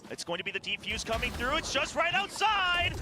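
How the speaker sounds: high, fast hype play-by-play; esports caster. Acted. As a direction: call every kill with rising energy. Play-by-play of overtime round.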